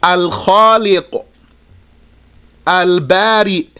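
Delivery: drawn-out, singsong list of Arabic names; measured recitation. Shown as a demonstration. Teacher reciting names that share this pattern, measured recitation. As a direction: recite each name separately with a rise and fall.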